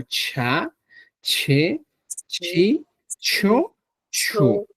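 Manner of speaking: "cha, che, chi, cho, chu" with a strong breathy puff after the ch; aspirated ch. Shown as a demonstration. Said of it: "Los aspirados se pronuncian como".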